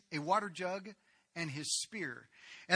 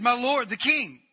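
louder, higher, calling across to the king; 7 semitones higher, 11 dB louder than usual. Acted. David calls out to King Saul from the cave, louder. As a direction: call out to him with respect from a distance. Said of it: "he called out to Saul".

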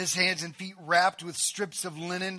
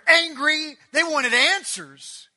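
loud, raised preaching emphasis; emphatic anger; 8 semitones higher, 8 dB louder, slower than usual. Real, not acted. Preacher echoing the sisters' anger.

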